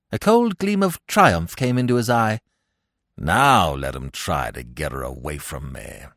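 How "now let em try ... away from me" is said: put-on low, defiant old man's mutter. A narrator's reading. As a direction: mutter it deep, as a challenge. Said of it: "he muttered defiantly".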